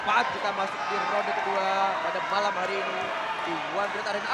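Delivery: usual speaking voice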